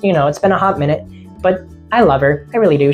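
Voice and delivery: ordinary talk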